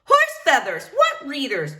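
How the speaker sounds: high, loud and laughing; laughing loud retort. Acted. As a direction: boom it out with a laugh. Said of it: "Rosalita did not whisper".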